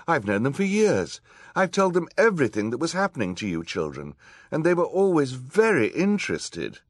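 high, loud, distressed insistence; protesting judge. A narrator's reading. Judge defending her colleagues to the children, high loud protest. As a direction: insist in shocked disbelief, pitched high.

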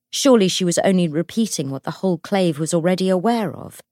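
usual speaking voice

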